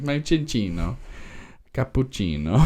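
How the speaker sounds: hammed-up Italian accent with a sing-song lilt; playful Italian accent. Fan playfully saying the Pokemon's name in a mock Italian accent. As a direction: croon Cincino and cappuccino like a mock Italian. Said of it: "I like saying the name in an Italian accent".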